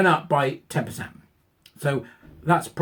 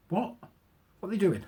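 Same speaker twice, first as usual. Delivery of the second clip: ordinary talk, nothing marked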